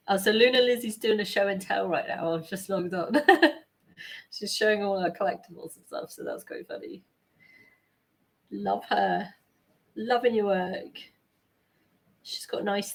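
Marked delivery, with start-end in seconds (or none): none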